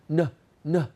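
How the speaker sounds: ordinary talk, nothing marked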